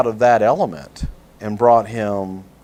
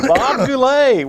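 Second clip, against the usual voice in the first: loud drawn-out name call; 14 semitones higher, 7 dB louder, slower than usual. Acted. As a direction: stretch the name out like a ring announcer. Mocking an aged celebrity guest.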